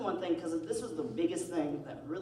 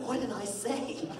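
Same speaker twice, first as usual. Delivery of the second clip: higher-pitched mock innocence; defensive retold question; 7 semitones higher, slower than usual. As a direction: protest innocence in a raised pitch. Acted defence in retold quarrel.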